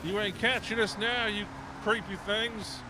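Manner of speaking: high, loud taunting yell; taunting shout. Acted. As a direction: jeer at the monsters, loud and high. Taunting pursuers in game.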